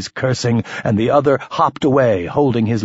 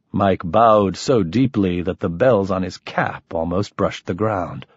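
ordinary delivery, nothing marked

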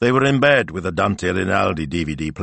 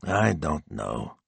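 slow, softer, doubtful; thinking it over; 3 semitones lower, 6 dB quieter, much slower than usual. A narrator's reading. Husband considers wife's suggestion, slow and thoughtful. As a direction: draw it out as you mull it over. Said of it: "thinking it over".

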